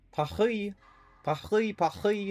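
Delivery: high, throaty French Paris with a guttural r; mock French. Streamer showing off his mock French to chat. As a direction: ham up the French Paree. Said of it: "I took a little bit of French class".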